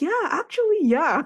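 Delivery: hammed-up delighted partner; high mock partner. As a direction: squeak it out as the surprised partner. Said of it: "And she's like".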